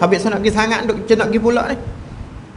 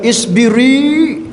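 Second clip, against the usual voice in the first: long drawn-out, high call; retold exhortation; 7 semitones higher, 4 dB louder, much slower than usual. Prophet urging a crying woman to be patient, drawn-out high call. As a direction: stretch it out, pitched well up.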